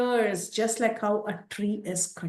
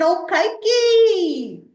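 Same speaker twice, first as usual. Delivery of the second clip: high, slow, loud wail; wailing lament; 11 semitones higher, 6 dB louder, much slower than usual. Acted. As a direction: cry out her name in grief. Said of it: "She started crying".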